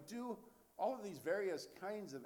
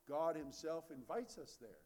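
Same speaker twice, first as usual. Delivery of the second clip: ordinary talk, nothing marked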